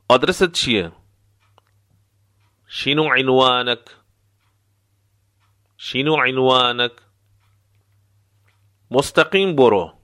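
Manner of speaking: ordinary talk, nothing marked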